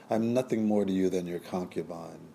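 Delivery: ordinary delivery, nothing marked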